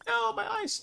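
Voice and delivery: very high little cry of dismay; dismayed yelp. Real, not acted. Gamer losing their ice, high-pitched dismayed squeal.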